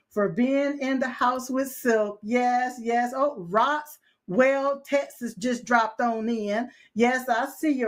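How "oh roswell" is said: ordinary delivery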